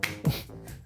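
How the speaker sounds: short, soft laugh; small chuckle. Real, not acted. Singer after joking about big arms, soft chuckle. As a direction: chuckle softly at your own joke.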